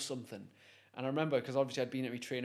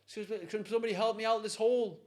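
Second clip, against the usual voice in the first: high, raised mock cry upward; imitated call for help; 8 semitones higher, 4 dB louder than usual. Stuck man looks up to passers-by, calling for help. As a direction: act out calling for somebody's attention. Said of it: "he looks up and he goes".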